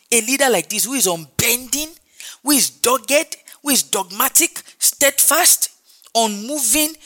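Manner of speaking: high, punched-out list, word by word; rousing, insistent preacher cadence; forceful preaching. Real, not acted. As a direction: hammer each quality home from the pulpit. Builds a list of qualities.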